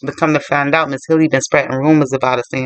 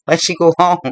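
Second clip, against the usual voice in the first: usual speaking voice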